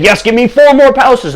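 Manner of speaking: high, loud, eager cry; greedy frenzy. Acted. King snapping up the lamp's offer, frenzied.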